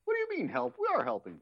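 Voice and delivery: very high squeaky mock voice; helping hands voice. Quoting the helping hands scene.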